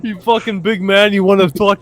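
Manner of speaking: cartoon Russian brawler; mock Russian tough guy. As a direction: pick a fight as a hammed-up Russian dwarf. Mock Russian dwarf picking a fight.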